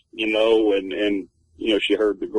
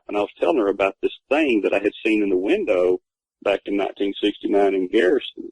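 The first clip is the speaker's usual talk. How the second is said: usual speaking voice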